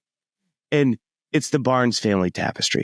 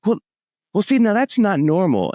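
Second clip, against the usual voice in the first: a pointed, raised declaration of what is wrong; mock firm verdict; 10 semitones higher than usual. Spouse-to-be declaring the family odd, mock firm verdict. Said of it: "And they're like".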